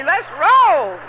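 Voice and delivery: loud, high let's-go call; mock bravado. Retold drunk self heading off, loud high rowdy call. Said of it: "acted drunk bravado".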